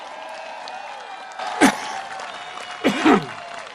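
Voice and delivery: harsh coughing fit. Real, not acted. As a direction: cough hard and dry. Cough during speech.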